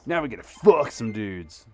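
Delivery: loud, slow, gleeful yell; hyped shout. Real, not acted. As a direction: bellow it like a rallying cry. Hyped exclamation after sad scene.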